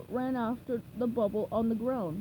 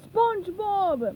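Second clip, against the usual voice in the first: higher, louder, slow drawn-out call; shout; 8 semitones higher, 6 dB louder, much slower than usual. A narrator's reading. Mr. Krabs yells after the vanishing bubble, slow loud call. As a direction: cry the name into the distance. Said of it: "Mister Krabs shouted".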